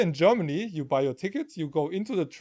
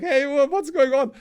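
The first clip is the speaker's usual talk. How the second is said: higher, louder mock bewilderment; retold puzzled call; 8 semitones higher, 5 dB louder than usual. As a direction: call out for help, baffled. Acted call for help at gate.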